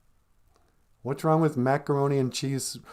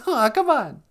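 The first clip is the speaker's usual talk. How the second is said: very high-pitched little character; sidekick voice; 13 semitones higher, 4 dB louder than usual. Pretend. Chirpy sidekick answering "How you doing, sweetie?", high character voice.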